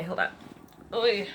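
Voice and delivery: ordinary delivery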